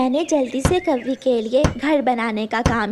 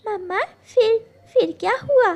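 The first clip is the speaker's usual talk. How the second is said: put-on high eager child begging for more; eager little girl; 8 semitones higher than usual. Little daughter begs mama to go on with the story, eager. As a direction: tug at mama for the rest.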